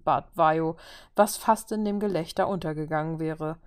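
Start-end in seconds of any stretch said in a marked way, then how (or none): none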